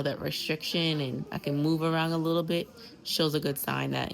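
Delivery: ordinary delivery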